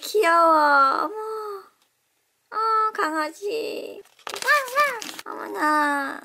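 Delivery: drawn-out cry of delight; cooing. Real, not acted. Host cooing at a cute puppy toy, delighted. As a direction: melt over how cute it is.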